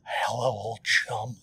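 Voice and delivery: put-on slow, hammy old Batman line; lightly voiced Adam West Batman. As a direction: lightly play campy Batman, slowly. Batman impression.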